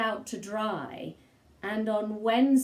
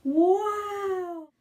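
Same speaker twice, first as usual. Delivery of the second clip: amazed gasp; 10 semitones higher than usual. A narrator's reading. Sid reaching the top, amazed wow. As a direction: gasp in wonder at the sight.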